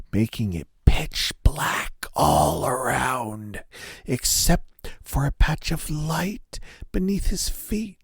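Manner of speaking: usual speaking voice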